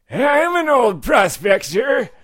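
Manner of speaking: put-on high, slow shouted old-timer; old prospector. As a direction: bellow it like a crusty gold miner. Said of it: "we have a character called Old Prospector".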